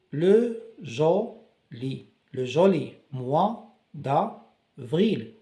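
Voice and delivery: slow, syllable by syllable; le joli mois d'avril stretched word by word; sounded out. Shown as a demonstration. Teacher to class, slowly sounding out the phrase. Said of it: "on découpe les mots pour bien prononcer".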